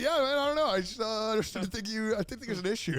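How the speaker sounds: mock easygoing surfer type; chill dude. Dancer shrugging off the misunderstanding, mock chill bro voice. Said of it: "he's like".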